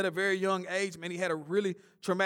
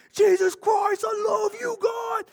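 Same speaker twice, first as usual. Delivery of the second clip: very high, loud shout of praise; hammed-up joyful shout to God; ecstatic shout; 6 dB louder, slower than usual. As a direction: belt it like the viral clip. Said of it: "They just started saying".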